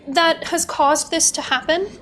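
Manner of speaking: usual speaking voice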